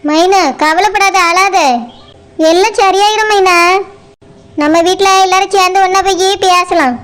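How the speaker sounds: put-on an older neighbour gently consoling a crying young wife; comforting older woman.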